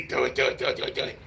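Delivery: low hissing tempter chant; demonic voice. Retold or imitated. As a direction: whisper it like a devil on your shoulder. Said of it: "The demonic voice in your head will say".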